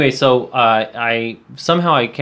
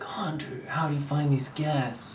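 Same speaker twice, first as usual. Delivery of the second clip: whispered quote; 4 semitones higher, 9 dB quieter than usual. Retold or imitated. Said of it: "Brian would always be like".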